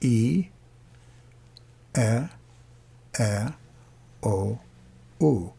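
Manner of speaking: pure open vowels, one by one; Latin vowels. Shown as a demonstration. Teacher modelling Latin vowels for singing students. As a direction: lay out each Latin vowel cleanly. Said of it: "Latin vowels".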